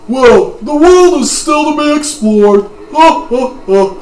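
put-on high, loud old warrior voice; hammy old master laughing; old man voice. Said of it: "how did my old man voice go?".

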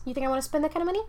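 very fast indignant rant; rapid rant. Real, not acted. Ranting about child costs, fast indignant. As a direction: rattle it off fast.

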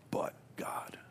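hushed, breathy "But God" on a falling tune; a quiet, reverent whisper of "But God". Acted. Whispered refrain.

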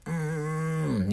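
high, held-in "mm" of pain; imitated pained groan. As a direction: mimic straining through the pain. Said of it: "I'm in that motherfucker like".

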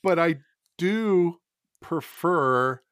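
picking each word with pauses; careful, halting. Real, not acted. Writer trying not to say like, speaking carefully. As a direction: feel your way word by word, avoiding a slip. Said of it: "I have to say this so carefully now".

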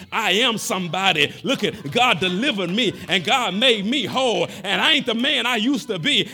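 shouted, fervent preaching. Acted. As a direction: preach it out at full force. Preaching at full force.

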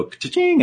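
sung-out cash-register ring; playful "ka-ching" sound effect; imitated register sound. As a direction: mimic a cash register chiming. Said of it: "and make a sound".

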